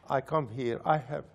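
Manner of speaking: normal talking voice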